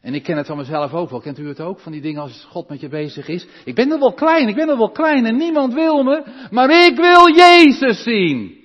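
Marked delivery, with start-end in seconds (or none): 3.6-8.6 loud, high, pleading cry of the small man; high loud yearning plea; voicing Zacchaeus. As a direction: cry out like a little man desperate to see Jesus. Preacher voices Zacchaeus longing.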